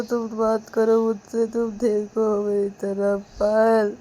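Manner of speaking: high, loud, tearful begging; pleading sobbing apology; crying plea. Acted. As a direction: beg her friend through tears. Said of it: "Ananya रोते रोते".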